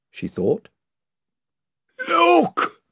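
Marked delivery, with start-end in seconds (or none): 2.1-2.8 high, loud sudden cry; hoarse cry. A narrator's reading. Rhinelf calls to everyone from the bows, a high loud cry. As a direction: call out from the bows. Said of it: "cried Ryanelf's voice hoarsely".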